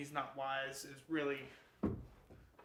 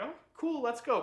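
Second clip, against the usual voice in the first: put-on much higher, louder breezy girl voice; carefree Catherine; 10 semitones higher, 9 dB louder than usual. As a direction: play her totally unbothered and chirpy. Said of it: "she's like".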